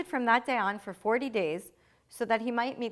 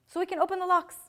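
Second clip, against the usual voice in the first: locks stressed, pitched higher; emphatic repeat; 8 semitones higher, faster than usual. Shown as a demonstration. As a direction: lift and land hard on locks. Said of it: "so that we can open the locks".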